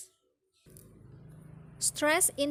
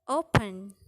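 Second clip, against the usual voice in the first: "open" with O stressed; first-syllable stress; 3 semitones lower than usual. Shown as a demonstration. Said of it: "first syllable is stressed".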